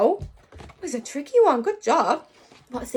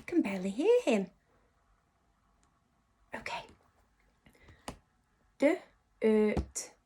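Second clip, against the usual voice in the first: usual speaking voice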